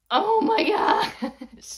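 high, loud, breaking into laughter; laughing mock dismay. Teacher exposed by a kid before the camera, laughing dismay. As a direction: groan at the kid's honesty, laughing.

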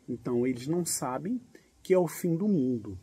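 usual speaking voice

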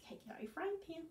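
soft whispered prompt. Real, not acted. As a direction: whisper it conspiratorially. Whispered aside to kids.

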